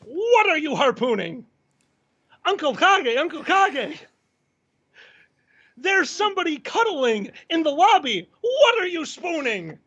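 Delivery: high-pitched, excited sing-song call; mock-frantic pleading, pitched well up; Kage. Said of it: "our favorite impersonation of Kage".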